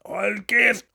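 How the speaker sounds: high, loud irritated outburst; irritated shout. A narrator's reading. Father tells his son to shut up, irritated shout. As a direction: snap it out as an annoyed father. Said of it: "råbte faren irriteret".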